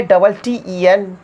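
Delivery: slow, letter-by-letter dictation; dictating each letter clearly; spelling out letters. Shown as a demonstration. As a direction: spell it out slowly for students. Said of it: "spelling".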